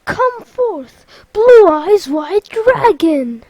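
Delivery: loud summoning cry, stretched at the end; battle summons. Acted. Sora interrupting Lexaeus's challenge, loud summons. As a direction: cut in with a grand call.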